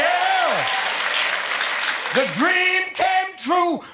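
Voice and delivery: high, slow, shouted gospel preacher voice. Acted. Preacher rejoicing at Obama's election to the crowd, shouting slowly. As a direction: testify like a revival preacher, drawn out and booming.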